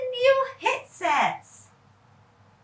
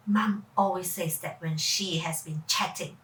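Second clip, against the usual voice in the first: plain reading voice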